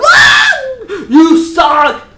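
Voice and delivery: very high, very loud furious yell; angry shout. Real, not acted. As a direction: blow up at them with a shriek. Ranting angrily at rude fans.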